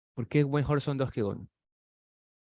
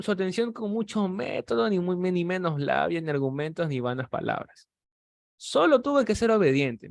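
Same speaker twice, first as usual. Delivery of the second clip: a preacher urging the church to obey, fervent; fervent preaching; 5 semitones higher than usual. Acted. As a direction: preach it out to the brothers. Preaching to the congregation.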